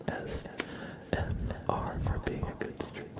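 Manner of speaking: whispered letter by letter; ASMR whisper. Acted. Tracing a viewer's name letter by letter, whisper. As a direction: shape each letter in a soft whisper.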